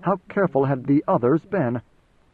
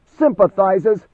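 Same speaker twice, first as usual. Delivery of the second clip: loud, raised, drawn-out echo of the word; incredulous shout; 6 semitones higher, 7 dB louder, much slower than usual. A narrator's reading. Commander answering the messenger's claim of sympathy, shouting in disbelief. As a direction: throw it back as a slow, outraged shout. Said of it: "Idaho shouted the word".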